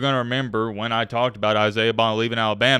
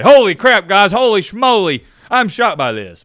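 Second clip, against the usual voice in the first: high, loud pretend shock, gasping it out; hammed-up surprise at Alabama news; mock shock; 8 semitones higher, 8 dB louder than usual. Vlogger faking shock at Alabama trending, loud and high. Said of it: "I wish I could sit up here and say".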